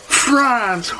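France! squealed very high and drawn out; thrilled gasp. Acted. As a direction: gush over the word France!. Holly s'extasie sur la France. (France! lancé très aigu, émerveillé)

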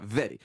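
"Very" low, with a tapped R; low regal "Very", nearly "veddy"; Queen Elizabeth II. Retold or imitated. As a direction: imitate royal "Very", R tapped at the front. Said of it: "c'est la seule à faire ça".

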